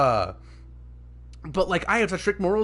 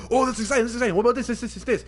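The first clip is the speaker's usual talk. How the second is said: higher, breathless-fan style babble; imitating excited chatter; 4 semitones higher, much faster than usual. Fan recalling chats about every leak, mimicking excitement. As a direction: reel it off like an eager theorist. Retells excited theorizing with friends.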